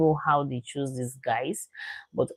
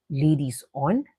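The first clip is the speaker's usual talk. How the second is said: ordinary delivery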